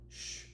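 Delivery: soft, long shush; gentle-volume shh; hushing shh. A narrator's reading. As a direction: quietly hush an upset child. Mother hushes her child.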